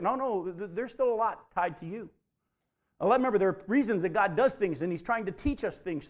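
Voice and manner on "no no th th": usual speaking voice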